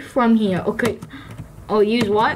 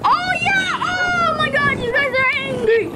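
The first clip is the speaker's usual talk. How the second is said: excited shout; 11 semitones higher than usual. Real, not acted. Excited as wolves attack sheep.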